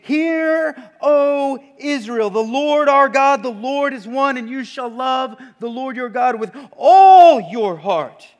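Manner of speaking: loud, high declamation of the Shema; proclaimed scripture. Acted. Preacher quoting Jesus to the congregation, declaiming loudly. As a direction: ring out the scripture like a herald.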